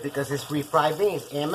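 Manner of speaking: usual speaking voice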